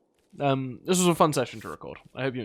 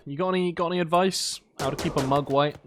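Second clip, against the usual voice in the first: usual speaking voice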